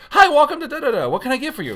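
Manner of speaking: high, loud chirpy greeting; sing-song peppy fast-food welcome; happy drive-through worker. Acted. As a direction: greet them with over-the-top cheer. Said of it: "like, a happy person".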